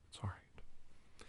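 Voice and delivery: slow hushed comfort; soothing whisper. Acted. Host mock-consoling a sobbing Bill, slow whisper. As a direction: whisper it slowly like soothing a child.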